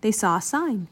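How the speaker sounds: plain reading voice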